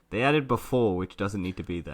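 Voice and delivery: ordinary talk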